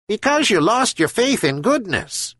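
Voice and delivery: high, wise gull voice; herring gull. A narrator's reading. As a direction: speak up high like a patient seabird. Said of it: "answered a herring gull".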